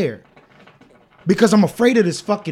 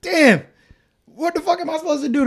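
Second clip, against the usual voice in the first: high-pitched, quick mock panic; retold panic; 11 semitones higher than usual. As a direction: blurt it high and fast, at a loss. Retells panic during the birth.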